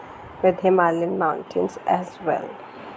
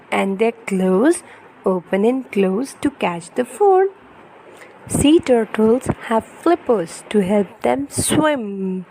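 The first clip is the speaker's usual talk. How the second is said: put-on lively, sing-song storybook reading for a toddler; reading to children; 3 semitones higher, 3 dB louder, slower than usual. Parent reading an ocean picture book to baby, animated storytelling. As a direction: read it brightly to hold a little one's attention.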